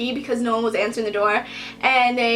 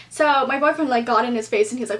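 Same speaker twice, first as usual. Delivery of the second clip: normal talking voice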